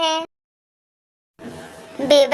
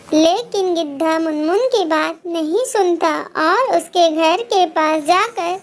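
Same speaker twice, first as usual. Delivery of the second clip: ordinary delivery, nothing marked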